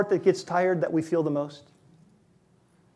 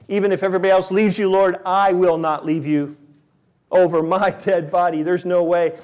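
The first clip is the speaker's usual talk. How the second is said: bragging Peter; 2 semitones higher, 8 dB louder than usual. Retold or imitated. Peter's boast retold.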